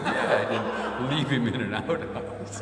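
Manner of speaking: slow, laughing reply; slow amused answer through laughter. Retold or imitated. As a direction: drag it out, laughing. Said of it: "I go".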